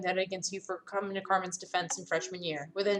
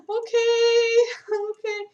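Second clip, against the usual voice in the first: nervous okay; 7 dB louder, much slower than usual. Real, not acted. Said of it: "I'm scared".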